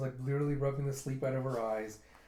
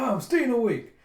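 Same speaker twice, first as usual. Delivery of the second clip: high, loud stubborn outburst; defiant mock yell; 10 semitones higher, 10 dB louder than usual. Pirate bashing his head to stay awake, defiant yell. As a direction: yell it to keep yourself awake.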